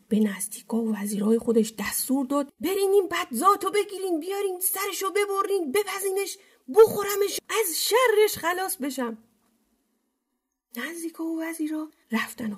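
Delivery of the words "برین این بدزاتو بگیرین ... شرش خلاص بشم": high-pitched, irate amir; angry order. A narrator's reading. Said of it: "امیر عصبانی شد". (دستور عصبانی با صدای زیر)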